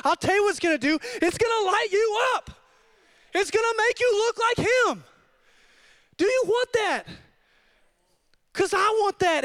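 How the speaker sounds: high, impassioned preacher's call; real preaching fervour; fired up. Real, not acted. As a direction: fire up the congregation, pitched high. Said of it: "I'm sorry I'm getting fired up".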